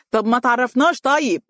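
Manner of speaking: high-pitched indignant exclamation; calling out. A narrator's reading. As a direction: throw the question up high. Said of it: "ويهتف".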